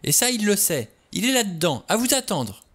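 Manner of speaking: high, agitated insistence; shrieky-edged, urgent alarm; frantic warning. A narrator's reading. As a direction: press the danger on a doubting listener. Vera argues in panic.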